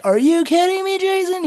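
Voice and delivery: high, loud mock outrage, not truly mad; teasing shout at a buddy who bailed on the clip; playful yell. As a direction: yell it at a friend in fun. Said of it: "I started yelling at him, like, not obviously mad".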